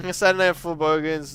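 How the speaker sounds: ordinary talk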